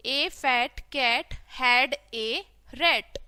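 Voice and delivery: very slow, separated words; word-by-word reading. Shown as a demonstration. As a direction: read it out slowly for beginners. Teacher models reading word by word. (धीरे-धीरे, एक-एक शब्द पढ़ना)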